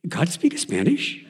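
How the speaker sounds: father marvels, drawn out slowly; a hint of a Spanish accent in the slow question; amazed question.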